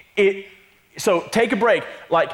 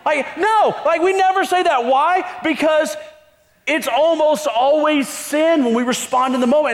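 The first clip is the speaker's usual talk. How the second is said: emphatic preaching; 9 semitones higher than usual. Real, not acted. Pastor urging the congregation, emphatic preaching. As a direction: drive the point home to the church. Preacher rises to full force.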